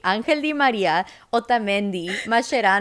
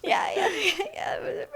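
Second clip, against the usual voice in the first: high, slow yeahs wobbling between laugh and sob; tearful laughing yeahs; 12 semitones higher, slower than usual. Retold or imitated. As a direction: nod it off while still crying. Teen fighting tears, promising calm.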